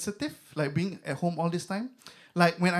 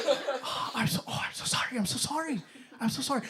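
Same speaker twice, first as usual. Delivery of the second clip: embarrassed whisper; 6 semitones higher, 6 dB quieter than usual. Retold or imitated. Receptionist embarrassed, apologizing.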